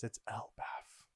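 slow, breathy hushed whisper; awed whisper. Acted. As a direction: breathe it out in awe. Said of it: "And Kid says".

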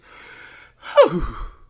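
loud exhaled breath; big steadying sigh; composing sigh. Real, not acted. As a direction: huff out a breath before starting. Composing before the reading.

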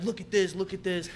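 high, loud mock indignation; mimicked pissed-off customer. Pissed-off customer calls manager to look, mimicked angry insistence. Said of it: "he's pissed off".

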